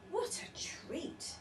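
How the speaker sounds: whispered with relief. A narrator's reading. Wife sinking into warm bed, whispered. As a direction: breathe it out contentedly. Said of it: "she said".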